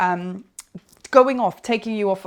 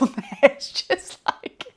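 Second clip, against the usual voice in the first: breathy laugh; 4 semitones higher, slower than usual. Real, not acted. Laughing at what is on Instagram.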